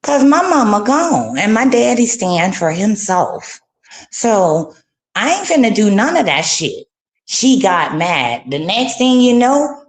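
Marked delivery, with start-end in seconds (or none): none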